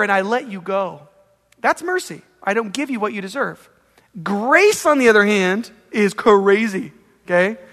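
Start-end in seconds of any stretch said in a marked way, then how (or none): none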